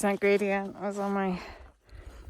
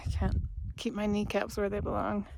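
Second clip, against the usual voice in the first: ordinary delivery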